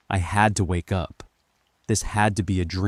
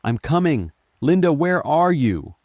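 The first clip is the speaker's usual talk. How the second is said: raised, urgent shout; panicked calling; 9 semitones higher, 6 dB louder than usual. A narrator's reading. Narrator runs toward Linda's scream, panicked shouting. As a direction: call out to find her, panicking. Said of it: "panic taking over".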